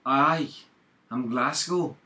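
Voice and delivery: a light mock Glasgow accent. A Glasgow accent voiced for a friend, lightly mocking. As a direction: hams up a Glaswegian asking where Glasgow is. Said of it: "I'll put in Glasgow accent".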